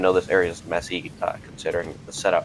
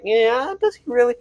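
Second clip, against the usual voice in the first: high, doubting mock voice; squeaky disbelieving voice; skeptical viewer; 4 dB louder than usual. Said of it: "y'all are like".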